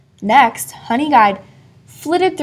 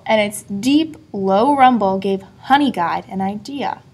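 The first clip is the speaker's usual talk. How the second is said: usual speaking voice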